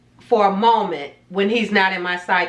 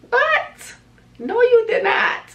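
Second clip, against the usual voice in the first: high, stunned disbelief; can't believe what he just told you; disbelieving what; slower than usual. Retold or imitated. Disbelief at divorce news.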